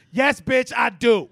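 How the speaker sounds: loud, sharp retort to a cashier; indignant shouted comeback, half-joking; sassy comeback. Retold or imitated. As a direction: fire it back in her face, loud. Snapping back at judging cashier.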